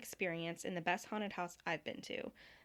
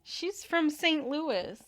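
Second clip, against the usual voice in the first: put-on slow, higher New Jersey accent, drawn out; 7 semitones higher, 5 dB louder, much slower than usual. Reading a review aloud for a friend, Jersey accent. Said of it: "Can you do this in a Jersey accent?".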